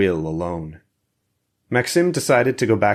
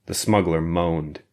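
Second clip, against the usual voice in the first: plain reading voice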